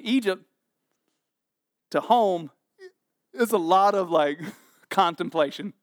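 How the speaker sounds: ordinary delivery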